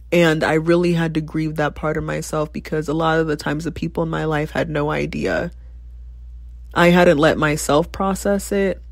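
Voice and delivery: usual speaking voice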